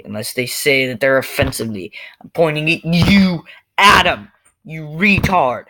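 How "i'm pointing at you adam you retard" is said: high, loud, emphatic call-out; loud scolding. Real, not acted. Scolding Adam for taking offence, loud rebuke. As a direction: call him out loudly, punching each word.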